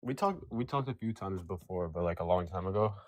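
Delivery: ordinary talk, nothing marked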